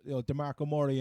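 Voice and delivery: normal talking voice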